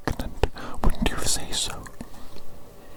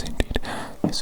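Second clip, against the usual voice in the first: normal talking voice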